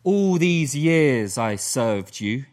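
slow, scornful and dismissive; contemptuous son. Retold or imitated. Elder son sneering at his father, slow and contemptuous. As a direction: talk down to the father, slowly. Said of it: "talking kind of down to God".

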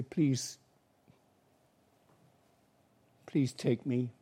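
soft, low pleading prayer; humble prayer. Pretend. A sinner asking Jesus to accept him, softly pleading. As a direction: pray it humbly, quietly, as if unworthy.

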